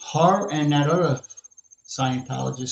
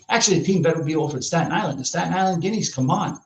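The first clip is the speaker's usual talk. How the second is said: ordinary talk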